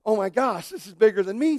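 lightly hammed alarm; mock panic. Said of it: "we always think it's a".

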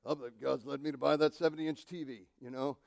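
lightly mocking the TV buyer; mock excuse. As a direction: play the self-justifying buyer. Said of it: "you go this afternoon and say".

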